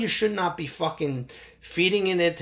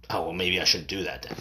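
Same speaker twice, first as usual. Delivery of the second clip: deterred criminal; 10 semitones lower, 3 dB quieter than usual. Acted. Voices the deterred would-be criminal.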